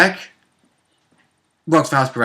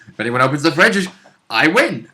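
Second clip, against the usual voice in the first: usual speaking voice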